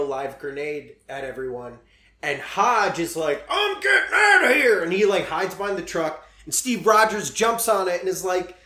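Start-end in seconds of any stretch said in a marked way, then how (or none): 3.5-4.8 high panicked yelp; panicked yell. Retold or imitated. Hodge fleeing grenade in story.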